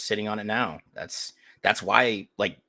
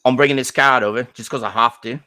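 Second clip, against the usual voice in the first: loud mock Cockney lad with a grudge; Cockney accent; 3 semitones higher, 8 dB louder than usual. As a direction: play a sneering Cockney son, loud. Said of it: "one of those Cockney fucks".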